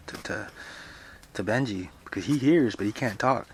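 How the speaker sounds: plain reading voice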